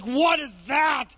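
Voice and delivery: high, slow panicked cry; a mock terrified outcry at the sight; freaked-out shout. Panicked reaction to ghost.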